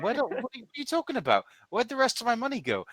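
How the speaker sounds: high, fast, loud indignant outburst; outraged player. Acted. Player demanding his money back from Dante, shrill outrage. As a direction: squeal it out in disbelief. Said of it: "And you say to him".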